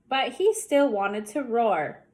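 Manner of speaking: ordinary delivery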